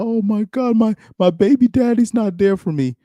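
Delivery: high-pitched mock woman's voice; complaining baby mama. Mocking women who complain about absent fathers, high whiny put-on voice. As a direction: imitate a whining mother, pitched far up. Acted complaint of a baby's mother.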